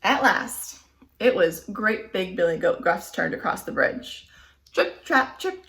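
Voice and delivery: plain reading voice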